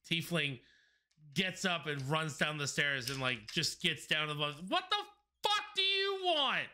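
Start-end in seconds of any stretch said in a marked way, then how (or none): 4.7-6.7 angry yell. Acted. Tiefling yells up from below.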